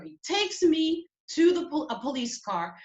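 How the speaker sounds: ordinary talk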